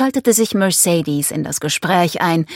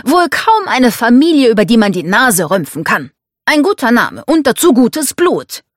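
louder, higher, emphatic argument; domineering father, insistent; 6 semitones higher, 5 dB louder than usual. Acted. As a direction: press the case for a favoured protégé.

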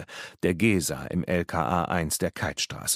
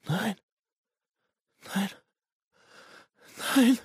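tiny high-pitched whimper. Acted. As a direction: whimper each word, long pauses between. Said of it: "winselte er". (hoch und kläglich winseln)

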